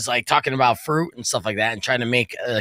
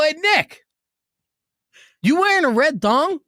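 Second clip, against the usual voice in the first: teasing call-out; 13 semitones higher, 4 dB louder than usual. Retold or imitated. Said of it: "I go".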